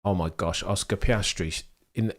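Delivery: usual speaking voice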